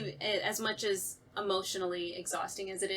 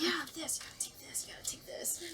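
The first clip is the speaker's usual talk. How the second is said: fading to a whisper; half-whispered pill pushing; hushed insistent parent; 7 semitones higher, 8 dB quieter than usual. Retold or imitated. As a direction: press the pills on them in a hush. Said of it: "And they're like".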